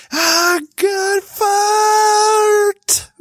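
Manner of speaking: very high, stretched-out yell; exaggerated shriek after a painful sneeze; retold pained outburst. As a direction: cry out high, dragging every word. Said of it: "I sneezed and I'm like".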